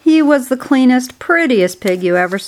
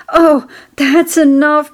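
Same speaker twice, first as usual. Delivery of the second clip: higher, louder, slow cry of greedy delight; cried out; 6 semitones higher, 4 dB louder than usual. A narrator's reading. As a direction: cry out, overwhelmed by the thought of food. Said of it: "cried Templeton".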